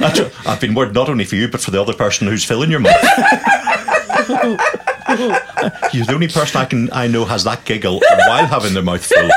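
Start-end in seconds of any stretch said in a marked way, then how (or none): 3.1-5.9 high squealing laugh. Real, not acted. Joking about mouth full.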